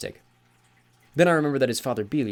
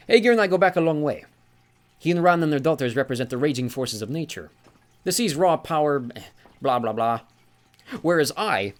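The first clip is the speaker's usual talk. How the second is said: put-on genial old sea god; 5 semitones higher, 6 dB louder than usual. Sea god corrects Magnus, jovial and higher. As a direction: lean on Granddad with amused mock offence. Njord voiced in story.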